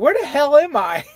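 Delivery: high, loud, baffled question; mock bewilderment. Traveler asking a stranger behind the counter, high mock bewilderment. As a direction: exclaim it in exaggerated confusion.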